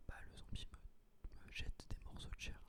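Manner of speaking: usual speaking voice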